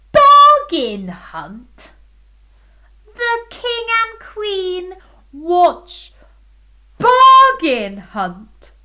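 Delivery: loud, slow, high disbelief; mock astonishment. A narrator's reading. Storyteller exclaiming at the king's TV choice. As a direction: stretch it out in shock.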